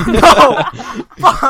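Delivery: loud, high laughing shout; laughing outburst. Real, not acted. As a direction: yell it through a burst of laughter. Laughing outburst after own impression.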